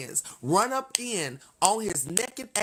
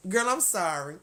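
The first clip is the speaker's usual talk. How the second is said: usual speaking voice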